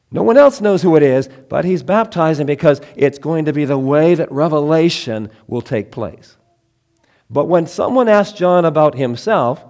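fervent sermon delivery; preaching. Acted. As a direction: preach it out to the congregation. Preaching opening with raised voice.

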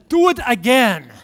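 loud, excited child imitation; eager little boy. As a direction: beg Dad like a thrilled small boy. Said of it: "swallows it and says".

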